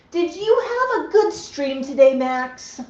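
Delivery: high, bright sing-song pet voice; pet talk. Real, not acted. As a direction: coo it up to the bird. Pet voice to the bird.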